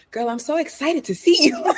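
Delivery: high, giggly excited greeting. Pretend. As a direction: gush with laughing excitement. Acting excited greeting, laughing.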